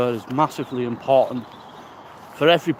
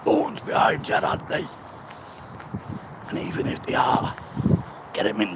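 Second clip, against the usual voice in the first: higher, breathy whispered taunt; paranoid inner voice; 6 semitones higher, 5 dB quieter than usual. Retold or imitated. His head taunting him on the walk, higher whisper. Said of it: "You see the way the head works?".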